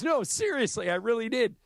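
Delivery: lightly voiced Hollywood producer pleading to be believed; a hint of a protesting big shot; insistent producer. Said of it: "He goes".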